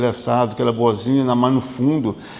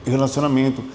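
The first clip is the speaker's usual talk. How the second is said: normal talking voice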